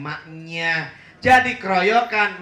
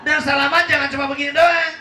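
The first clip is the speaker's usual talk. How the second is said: loud, fast correction; 8 semitones higher, 6 dB louder, faster than usual. Shown as a demonstration. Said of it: "Salaman yang bener tuh kayak gini nih".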